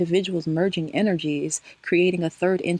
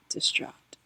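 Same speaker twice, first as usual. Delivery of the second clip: whispered; 7 dB quieter than usual. Acted. Telling viewers about her breakup, whispering the word "Distraught". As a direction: breathe "Distraught" as a soft unvoiced whisper.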